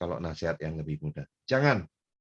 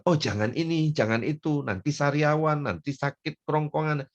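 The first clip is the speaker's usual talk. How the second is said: higher-pitched list of warnings; fussing parent; 7 semitones higher than usual. Acted. Parent vetoing a child's food choices, fussily nagging. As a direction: nag like an overcautious parent. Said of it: "Jangan bilang".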